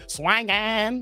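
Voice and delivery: "Swinging" sung as "swanging" with a twang; twangy swinging. Retold or imitated. Mocking the twang on "Swinging". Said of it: "He put the twang on it".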